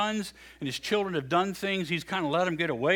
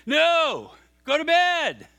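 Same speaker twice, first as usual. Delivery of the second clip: fed-up priest; 10 semitones higher, 8 dB louder, much slower than usual. Retold or imitated.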